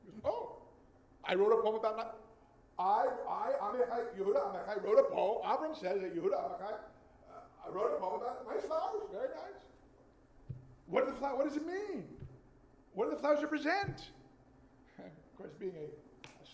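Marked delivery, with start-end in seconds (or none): 0.2-5.4 high, loud mock Israeli accent; voicing the poet teacher in a thick Israeli accent; Israeli accent imitation. Professor poet questioning his student, in a mock Israeli accent. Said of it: "he has a much thicker accent". 7.7-14.1 high, loud mock Israeli accent; voicing the poet teacher in a thick Israeli accent; Israeli accent imitation. Professor poet questioning his student, in a mock Israeli accent. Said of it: "he has a much thicker accent".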